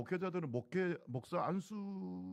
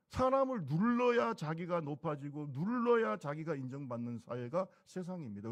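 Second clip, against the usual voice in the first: forceful preaching; 5 dB louder than usual. Acted.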